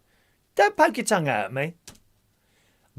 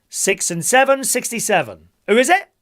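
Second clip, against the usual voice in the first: bingo caller; 4 semitones higher, 5 dB louder than usual. Acted. Calls bingo numbers.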